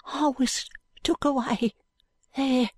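hushed high urchin, slowly confessing. A narrator's reading. Jo secretly telling Allan of his removal, hushed high voice. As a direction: confide it in a small, slow, high voice. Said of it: "says in a low voice".

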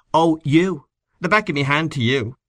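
high, sharp tart retort; wife brushing off her husband, tartly. A narrator's reading. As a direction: snap it back tartly at your husband. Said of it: "said Missus Kernan tartly".

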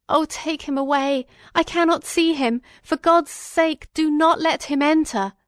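high-pitched, desperate begging; agonised cry. A narrator's reading. Sick prisoner begging that the visitor be kept out, in agony. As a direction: cry out in dread, pleading. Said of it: "cried out in agony".

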